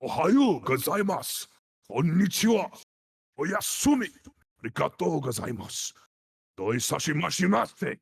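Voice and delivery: exaggerated Japanese dub intonation; imitating Japanese actor. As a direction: swoop each greeting up high like a Japanese anime actor. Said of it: "a Japanese actor doing it like".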